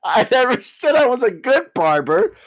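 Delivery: trying to stay in character while laughing; laughing through it. Real, not acted. Customer cracks up mid-scene.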